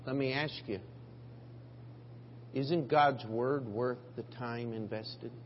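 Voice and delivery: usual speaking voice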